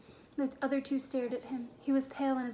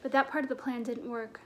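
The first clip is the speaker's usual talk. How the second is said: usual speaking voice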